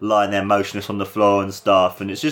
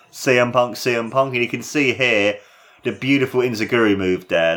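ordinary talk, nothing marked

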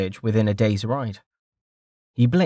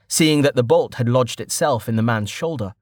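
ordinary delivery, nothing marked

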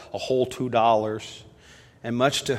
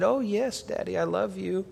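lightly voiced small girl, higher; little girl; 8 semitones higher than usual. Five-year-old answering her daddy at bedtime, lightly voiced little girl.

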